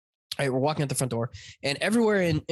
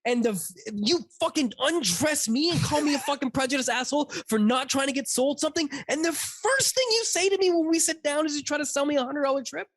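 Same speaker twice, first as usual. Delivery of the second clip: much higher, incredulous protest; retold indignant rant; 10 semitones higher than usual. Passenger to hypocritical taxi driver, retold indignant rant. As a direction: pile up the outrage in a raised pitch.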